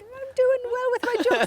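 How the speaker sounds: high, bright-eyed mock innocence; mock naive performer voice; oblivious comic. Oblivious comic answering heckler.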